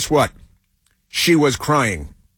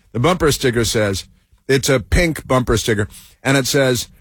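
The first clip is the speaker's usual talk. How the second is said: ordinary talk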